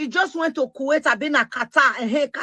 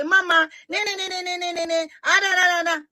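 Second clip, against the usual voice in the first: mock whiny young girl; 6 semitones higher than usual. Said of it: "young girl D M me say".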